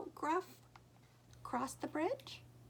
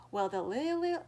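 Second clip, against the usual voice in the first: ordinary delivery, nothing marked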